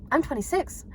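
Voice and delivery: ordinary talk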